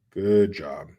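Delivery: flat, firmer sarcastic "Good job"; sarcastic praise. Real, not acted. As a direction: land "Good job" as a dry jab. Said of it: "Good job, Pennsylvania".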